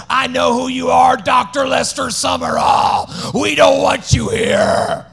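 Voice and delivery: slow, loud menacing demon. Retold or imitated. Demon confronting the preacher at the door, loud and menacing. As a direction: growl it out as the demon. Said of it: "this demon stopped him and said".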